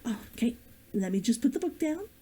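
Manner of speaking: plain reading voice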